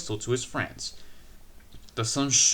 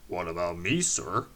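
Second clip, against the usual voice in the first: put-on old engine voice; 6 semitones lower than usual. A narrator's reading.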